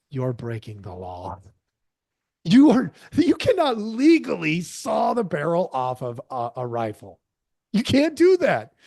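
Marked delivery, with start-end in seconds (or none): 2.4-8.7 loud, rising, exasperated; indignant outburst. Real, not acted. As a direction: call it out, outraged. Indignant outburst about breaking law.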